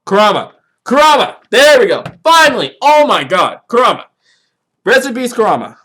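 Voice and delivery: sudden loud, high burst of relief at remembering; excited relief. Real, not acted. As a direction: shout the name out like you finally found it. Finally remembers the name.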